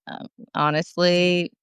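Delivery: ordinary talk, nothing marked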